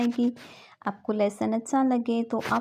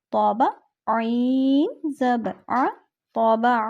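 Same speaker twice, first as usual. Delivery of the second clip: slow spelling drill; slower than usual. Shown as a demonstration. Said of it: "spelling drill".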